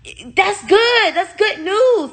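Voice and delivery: mocking the nurse. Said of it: "And she was like".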